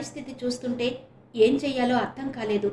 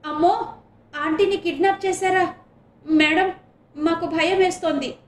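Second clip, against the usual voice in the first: loud, high frightened cry; frightened shout; 5 semitones higher, 7 dB louder than usual. A narrator's reading. The girls cry out in fear about a kidnapping, loud scared cry. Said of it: "పిల్లలంతా గట్టిగా అరిచారు".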